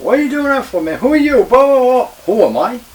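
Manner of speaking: very high, loud re-enacted yelling; angry confrontation. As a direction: scream at the rookie in outrage.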